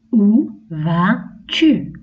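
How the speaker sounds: very slow "Où vas-tu", stretched out; very slow question. Shown as a demonstration. Teacher repeats the question very slowly for children. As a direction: drag the question out gently. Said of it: "Par exemple".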